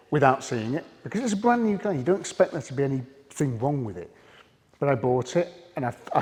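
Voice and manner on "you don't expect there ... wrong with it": ordinary delivery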